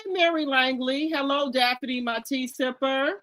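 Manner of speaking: usual speaking voice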